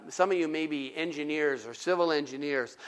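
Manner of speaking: ordinary talk, nothing marked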